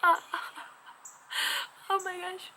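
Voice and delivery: theatrical mock crying; mock sobbing. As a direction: fake a wailing cry. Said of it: "Sometimes people are like".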